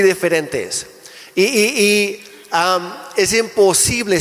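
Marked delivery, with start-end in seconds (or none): none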